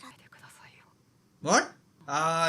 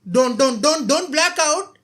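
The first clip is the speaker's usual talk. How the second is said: begging shout; 7 semitones higher, 9 dB louder than usual. Real, not acted.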